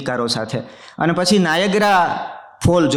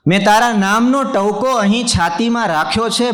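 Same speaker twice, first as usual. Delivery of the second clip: higher, ringing mushaira repeat of the line; poetry recitation; 5 semitones higher, 3 dB louder than usual. Acted. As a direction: lift the verse up to the audience. Repeats the couplet line for effect.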